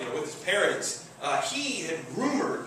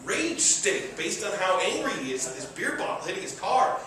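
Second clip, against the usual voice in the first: normal talking voice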